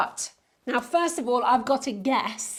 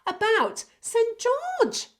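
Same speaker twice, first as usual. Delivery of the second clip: higher and louder, hand it over with a flourish; announcer build-up; 10 semitones higher, 3 dB louder, slower than usual. Acted. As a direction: introduce him like a star. Announcing the guest film.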